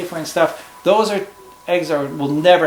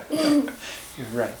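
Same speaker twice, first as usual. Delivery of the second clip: laughing; 9 semitones higher than usual. Real, not acted. Parent laughs at child's remark.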